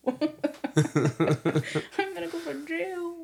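slow, laughing mock despair; laughing panic. Drunk her fearing arrest, laughing. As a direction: wail it through laughter.

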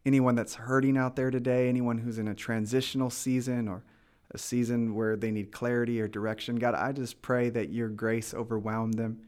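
pastor's blessing prayer, growing stronger; fervent prayer. Acted. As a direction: pray over the listeners, rising in fervour. Pastor prays over listeners.

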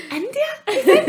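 imitating the friend, teasing her; mock naive friend. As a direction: send up the friend's guess. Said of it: "She was like".

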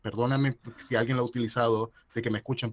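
ordinary talk

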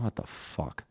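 whispered to himself, very soft; hushed disbelief. Retold or imitated. Nervous comic backstage hearing of a guest spot, whispered dismay. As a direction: drop to a whispered curse.

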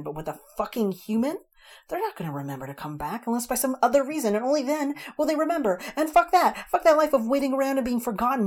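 fast, loud, rising to a high yell; tearful fairy raging at the human she loves; ranting. A narrator's reading. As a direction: escalate into a furious tirade. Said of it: "She kept ranting on".

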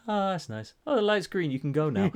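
high, lightly voiced mocking sweetness; a hint of a cooing woman; mock sweet Gracie. Gracie voiced watching the kiss.

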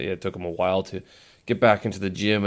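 ordinary talk

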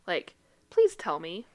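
ordinary talk

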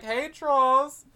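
high-pitched put-on hello; cutesy imitation greeting; mock timid greeting. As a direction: pipe up in a silly high voice. Orcs greeting trolls, acted.

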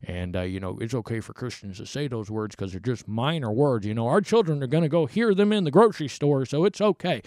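low, offhand mimicry of someone excusing themselves; mock casual rationalizing; mimicked excuse-maker. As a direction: imitate someone breezily justifying it. Mimics excuse he then rejects.

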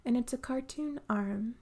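soft, hushed imitation of a meditation guide; soothing voice. Instructor guiding the sound bath meditation, soft soothing hush. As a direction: breathe it out gently, half whispered, like leading a class. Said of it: "in her beautiful, soothing voice".

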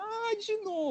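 high, strained whimper; mock crying whine. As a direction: whine it like tears are coming. Retelling crying again at film.

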